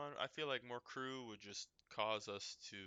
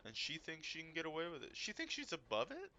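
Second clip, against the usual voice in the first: mock indignation; 7 semitones higher than usual. Mock outrage continues.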